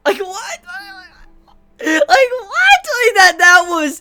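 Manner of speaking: high, squeaky disbelief through laughter; cracking up. Real, not acted. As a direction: barely get it out, wheezing with laughter. Cracking up in disbelief over the joke.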